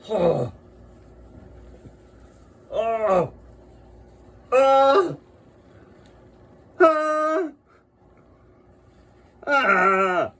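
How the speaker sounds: loud high oohs and aahs of awe; delighted moaning. Real, not acted. Marvelling at the badges, loud awed moans. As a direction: moan in wonder at each detail. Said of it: "just moaning and screaming".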